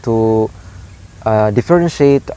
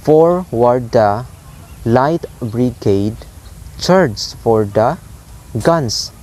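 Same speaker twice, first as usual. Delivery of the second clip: slow "Forward the / light brigade / charge for the / guns", paused between feet; each foot drawn out, "Forward", "light", "charge" leading; dactylic scansion; slower than usual. Shown as a demonstration. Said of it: "So it's like high, low, low".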